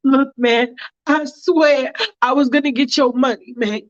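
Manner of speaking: a scared man pleading over money; pleading debtor. Acted. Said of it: "And he's like".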